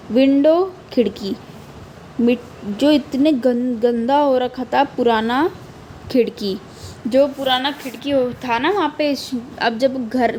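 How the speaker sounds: normal talking voice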